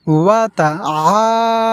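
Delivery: ওয়া তাআলা with the আ held long; slow recitation, held vowel; elongated vowel. Shown as a demonstration. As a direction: stretch the আ in তাআলা. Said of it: "টেনে পড়ব এইভাবে".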